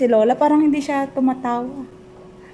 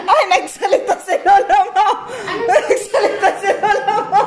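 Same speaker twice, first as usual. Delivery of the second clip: high, loud laughing cry; excited laughing exclaim; 8 semitones higher, 4 dB louder than usual. Real, not acted. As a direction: call out in delight. Excited lola spoke. (masayang sigaw habang tumatawa)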